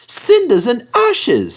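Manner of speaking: dismayed exclamation. Acted. Thomas stuck, exclaiming.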